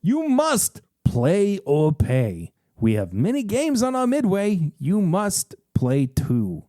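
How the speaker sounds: slow, looming Brooklyn barker; menacing barker. Acted. Big Al threatens the player, slow and menacing. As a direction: spit out the word must. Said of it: "He practically spits the word must out".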